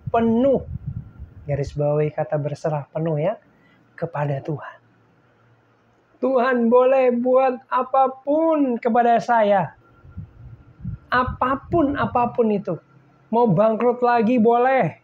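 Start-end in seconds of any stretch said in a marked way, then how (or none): 6.2-15.0 fervent prayer. Retold or imitated. Retold fervent prayer of surrender.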